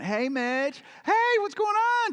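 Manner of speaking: a mock Southern designer, slow and sing-song; Southern accent. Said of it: "Southern accent".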